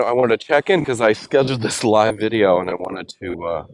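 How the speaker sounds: ordinary talk, nothing marked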